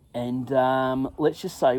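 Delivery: ordinary talk, nothing marked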